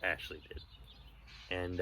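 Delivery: ordinary delivery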